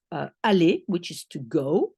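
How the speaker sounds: ordinary delivery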